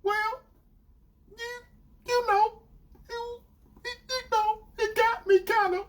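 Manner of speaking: very high, halting mimicry; stalling girlfriend. Girlfriend avoiding explicit detail, imitated haltingly. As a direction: stammer evasively in a high voice.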